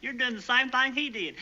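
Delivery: ordinary talk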